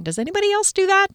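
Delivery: host asking the audience; playful question. Real, not acted. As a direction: toss it to listeners. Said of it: "asks listeners".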